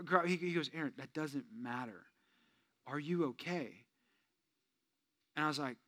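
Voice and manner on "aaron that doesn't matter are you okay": tender concern. Retold or imitated. Friend's caring question.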